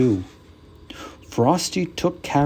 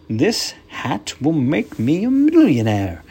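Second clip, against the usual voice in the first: greedy magician; 5 semitones higher, 4 dB louder than usual. Acted. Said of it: "said the greedy magician".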